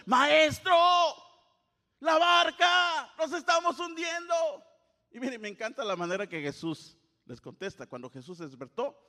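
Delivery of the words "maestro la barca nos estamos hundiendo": high panicked yell; shouting. Acted. Disciples shouting at Jesus as boat sinks, panicked yell. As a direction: scream it like the boat is sinking. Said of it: "estaban gritando".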